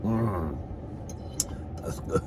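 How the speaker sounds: usual speaking voice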